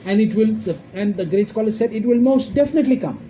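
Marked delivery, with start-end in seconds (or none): 1.9-3.2 high-pitched stress on MOST DEFINITELY; emphatic. Retold or imitated. Preacher quoting a great scholar, DEFINITELY said emphatically. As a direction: drive home DEFINITELY with raised pitch. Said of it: "Why are they emphasizing so much?".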